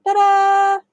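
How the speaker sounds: high, loud ta-da; triumphant ta-da. Real, not acted. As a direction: announce it like a magic trick. Showing the finished step.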